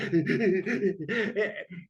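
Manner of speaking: mock stuttering sobs of a child; imitated sobbing. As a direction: imitate a kid blubbering in quick bursts. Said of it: "this child or teenager crying".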